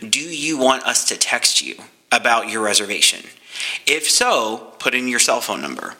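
ordinary talk, nothing marked

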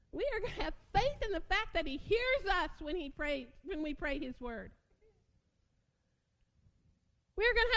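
soaring pulpit proclamation; full-force preaching. Acted. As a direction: lift the room with each phrase.

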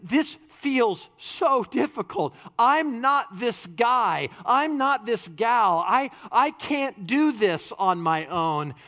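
slow anguished confession; despairing believer. Retold or imitated. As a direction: sound crushed by the impossible standard. Voicing a crushed believer.

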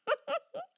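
quick high burst of laughter; a real laugh slipping out; short laugh. Real, not acted. Laughing at dad's funny answer, real laugh.

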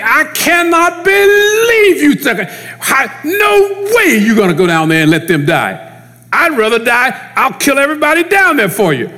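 Peter's outburst. Retold or imitated. Peter protesting Jesus's death, high and outraged. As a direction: blurt it out like a furious loyal friend. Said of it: "Peter's saying".

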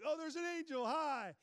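high, loud, gleeful greeting; hammed-up joyful wonder; mock delight. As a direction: imitate someone thrilled by what he sees. Imitates the happy guy greeting angels.

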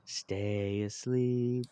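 slow, soft hushing; soothing lullaby voice. Acted. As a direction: gently coax a child to sleep. Soothing child back to sleep.

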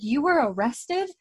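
high, slow incredulous question; mock shock. Bree finding Murta jailed, mock shock. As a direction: gasp it in disbelief.

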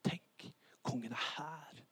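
slow, awed whisper; dignified awe. Acted. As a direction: whisper it slowly in wonder. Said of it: "Det blir verdighet". (langsom, andektig hvisking)

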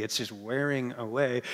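wearing said low and soft, fading out; tired-sounding. Shown as a demonstration. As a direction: let wearing away sag like a worn-out day. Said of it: "it sounds like it's just, it's a tired day already".

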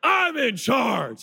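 high, hammed-up shout at a son; drawn-out, over-the-top parental yell. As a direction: bellow like a dad pulling rank. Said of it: "the louder I get".

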